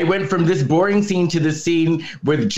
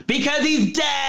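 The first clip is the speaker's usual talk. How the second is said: high, shouted deadpan reveal; punchline shout; 11 semitones higher than usual. Real, not acted. Telling co-hosts why grandpa's absent, shouted. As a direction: blurt the punchline.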